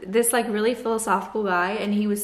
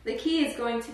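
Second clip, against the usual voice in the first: usual speaking voice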